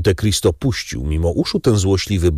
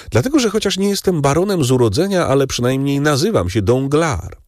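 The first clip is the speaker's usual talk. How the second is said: higher, fast, self-satisfied baron; Danglars, proud baron; 7 semitones higher than usual. Acted. Baron explains to the count why his name stands, higher and fast. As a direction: rattle off your own name with pride.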